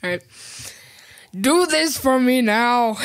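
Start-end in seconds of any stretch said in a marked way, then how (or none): 1.4-3.0 slow, higher bossy order; hammed-up father giving a chore; dad impression. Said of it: "do an impression of me".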